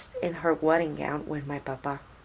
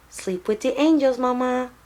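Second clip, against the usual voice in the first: put-on higher child calling out the window; mild, higher child's call out of a school bus window; 6 semitones higher, 3 dB louder than usual. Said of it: "I call out the window".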